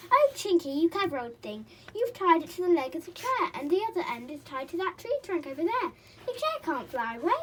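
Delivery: high, loud praise; boy delighted with Chinky; admiring boy. A narrator's reading. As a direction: cheer the clever pixie.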